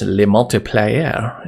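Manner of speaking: slow, hammed-up French "le multiplier"; drawn-out joke French "le multiplier"; mock French accent. Shown as a demonstration. Coding tutor jokes that the name sounds French, slow mock French accent. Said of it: "that almost sounds French".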